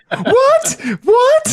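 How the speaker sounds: very high, incredulous squeak; high-pitched self-mockery. Host mocks own voice on hearing it back, high squeak. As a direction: squeak it way up high in mock disbelief. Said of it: "Is that really me?".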